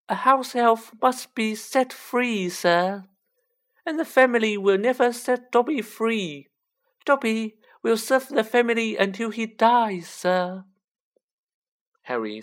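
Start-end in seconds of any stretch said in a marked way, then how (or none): 0.1-10.7 high, loud, squeaky elf; high-pitched Dobby. A narrator's reading. Elf resigned to lifelong service, high-pitched. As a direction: state it high and earnest. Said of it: "in a high-pitched voice".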